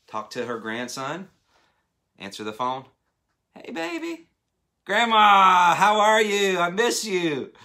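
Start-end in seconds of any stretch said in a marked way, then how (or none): 3.5-4.3 very high-pitched doting grandma; grandma on the phone. Acted. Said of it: "if it was grandma, she's calling". 4.9-7.5 loud, high, gushing hello to grandma; excited greeting. Acted. Acted grandson greeting grandma on phone.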